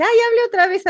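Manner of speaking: gushing fan. Retold or imitated. Fans begging her to speak again, mock gushing high voice. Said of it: "que te dice".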